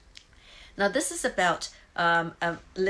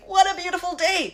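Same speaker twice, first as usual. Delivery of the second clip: much higher and louder, beaming; joyful exclamation; 12 semitones higher, 10 dB louder than usual. Retold or imitated. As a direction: shout it to the morning with delight. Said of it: "I'm going".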